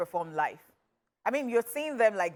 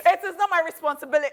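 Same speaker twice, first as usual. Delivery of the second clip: laughing; 8 semitones higher, 6 dB louder, faster than usual. Real, not acted. Laughing protest.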